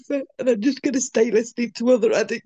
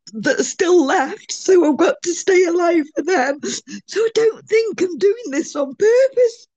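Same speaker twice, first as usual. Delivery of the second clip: real tearful, higher-pitched voice; crying; 3 semitones higher, 4 dB louder than usual. Real, not acted. Addict confessing to the meeting about hanging on, crying. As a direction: talk through tears, voice rising. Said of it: "I'm really sorry that I'm crying".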